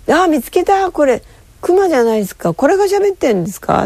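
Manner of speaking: high, fast, delighted; thrilled to find the talking bear; excited discovery. Retold or imitated. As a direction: pitch it up high and rush with glee. Retold excitement finding the bear.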